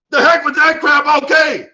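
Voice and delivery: loud, high acted outburst; emphatic dismissal. Speaker voicing a teammate rejecting okay, loud dismissal. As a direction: shout it as a defiant rejection.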